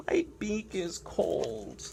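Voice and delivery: complaining penguin. Acted. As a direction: sulk through it like a cold penguin. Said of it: "Doing my complaining voice hurts".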